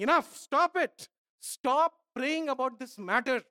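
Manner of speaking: God shutting the matter down; stern command. Acted. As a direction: cut off the pleader firmly. God's stern rebuke.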